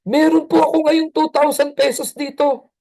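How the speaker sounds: put-on thrilled kid announcing his savings; excited child. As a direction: show off the money to dad. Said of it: "Excited yung bata".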